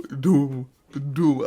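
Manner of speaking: loud mock footstep booms for each step; loud booming doom. Teasing a heavy friend walking in, booming doom. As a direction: boom out each step like a giant. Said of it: "Every step".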